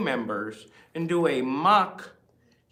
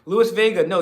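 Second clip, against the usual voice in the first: ordinary talk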